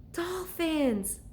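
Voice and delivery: high delighted cry; girl crying out at dolphins; excited cry. Acted. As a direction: spot the dolphins with glee. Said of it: "cried Annie".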